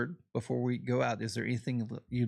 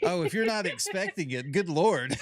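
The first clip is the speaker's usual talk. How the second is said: amused exclamation; 7 semitones higher, 5 dB louder than usual. Real, not acted. Reacting to sweet tea face.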